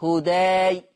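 held هداي, final aa kept open; fatha on هداي. Shown as a demonstration. Teacher repeating the fath for students, open aa in هداي. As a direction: open the jaw upward on the aa of هداي. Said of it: "كده فتح".

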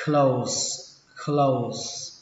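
"close" ending in an s sound. Shown as a demonstration. Teacher showing learners the ending, "close" with s. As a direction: finish "close" on a hissed s, not z. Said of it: "có thể đọc là âm sở".

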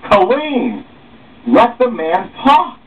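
sharp yell to cut her off; shout. Acted. Leader yelling to stop interruption.

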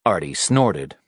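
ordinary delivery, nothing marked

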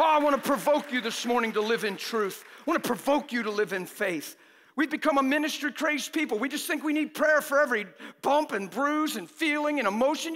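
ordinary delivery

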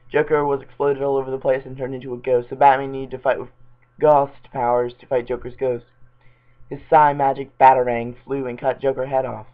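reading voice for a silly story; fanfic reading. Acted. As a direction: read the bad fanfic out straight. Reads Batman fanfic aloud.

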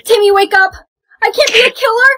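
high tearful screaming; panicked crying scream. Acted. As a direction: scream in horror. Friend dying, panic.

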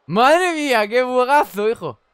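loud, high astonished cry; amazed shout. Real, not acted. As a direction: exclaim at the glitch. Reacción a un bug del juego. (grito de asombro ante el bug)

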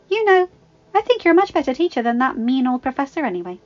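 put-on high, loud girlish chatter; eager teenage girl. A narrator's reading. Belle's voice, flattering her mother.

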